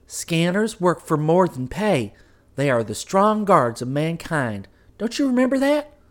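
the wife insisting, reminding him; insistent wife. Acted. Wife figure voiced.

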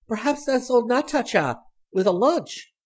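put-on high, loud girlish excitement; excited guess. A narrator's reading. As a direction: pipe up hopefully, high and loud. Sally excited at the knock.

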